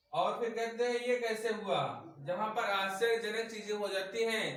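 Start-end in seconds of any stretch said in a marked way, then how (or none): none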